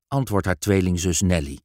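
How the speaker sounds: usual speaking voice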